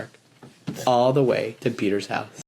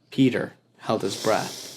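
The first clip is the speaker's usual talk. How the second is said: plain reading voice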